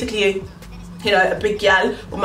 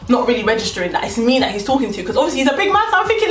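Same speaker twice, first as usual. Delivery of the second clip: usual speaking voice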